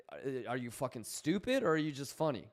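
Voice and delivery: ordinary talk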